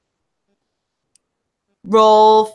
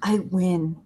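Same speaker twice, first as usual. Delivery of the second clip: dying breath; 6 semitones lower than usual. Acted. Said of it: "In his dying breath, he says".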